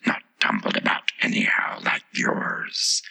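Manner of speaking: slow, whispery flower voice; interrupting Tiger Lily. A narrator's reading. The Tiger Lily interrupts the Rose, slow and whispery. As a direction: cut in slowly, all breath. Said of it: "the Tiger Lily interrupted".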